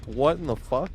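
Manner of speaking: half-acted stunned reaction; mock disbelief. To the whining teammate, stunned mock disbelief. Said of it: "It was just like".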